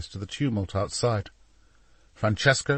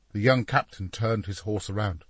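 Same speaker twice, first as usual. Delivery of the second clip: ordinary delivery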